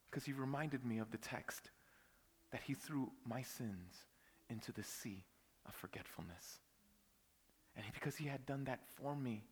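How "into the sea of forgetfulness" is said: dropping to a whisper. Real, not acted. Sermon climax, dropping voice.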